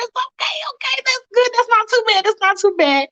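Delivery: high, giddy relieved voice; happy Michael. Retold or imitated. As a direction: squeak out the relief.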